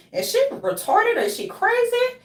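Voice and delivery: high-pitched, mocking questions; mock incredulous onlookers. Imitating people judging her, high mock disbelief. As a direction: pitch way up, imitate baffled critics. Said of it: "That's why zombies be like".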